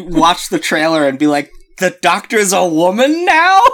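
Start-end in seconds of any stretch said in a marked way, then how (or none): 1.8-3.6 high, incredulous rising question; exaggerated disbelief, climbing at the end; mock shock. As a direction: imitate someone scandalized by news. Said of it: "I thought you were gonna be".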